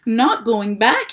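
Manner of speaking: loud, slow, rising cry; cried out. A narrator's reading. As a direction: blurt it out in alarm. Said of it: "he cried".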